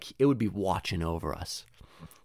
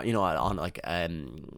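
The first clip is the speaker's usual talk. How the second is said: usual speaking voice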